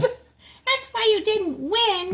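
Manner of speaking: high, drawn-out mock child scolding; scolding sister. Older sister blaming the girl for losing, mock scolding. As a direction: imitate a bossy little sister. Co-host mimics the bossy sister.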